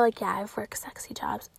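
soft unvoiced whisper; hushed admission. Real, not acted. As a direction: confide it quietly. Whispered confession.

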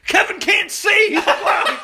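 high, loud frantic father; frantic dad. Retold or imitated. Dad to mom at the door, frantic announcement. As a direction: blurt it like a panicked dad. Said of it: "he's like".

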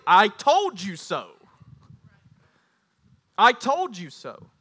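loud, drawn-out I-told-you-so; mocking. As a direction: rub it in slowly and loudly. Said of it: "It will mock you".

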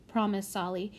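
ordinary delivery

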